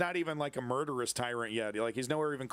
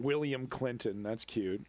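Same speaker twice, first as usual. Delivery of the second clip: normal talking voice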